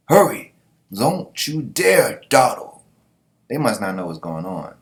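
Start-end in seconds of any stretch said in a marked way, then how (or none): 0.1-2.8 high, loud hurry-up, then drawn out; urgent captain. Acted. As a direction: order the crew to move, raised and emphatic.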